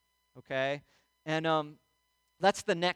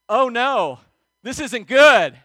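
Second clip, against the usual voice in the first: loud, higher-pitched alarm; mock alarm; 5 semitones higher, 15 dB louder, slower than usual. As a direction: exclaim it in dawning dread, much louder. Retold alarmed thought while falling.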